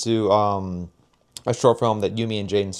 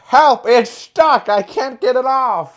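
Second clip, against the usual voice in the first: high, shrill panicked bad actor line; overacted high-pitched panic, hammy and stiff; bad acting; 14 semitones higher, 7 dB louder, slower than usual. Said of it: "the line delivery is so bad".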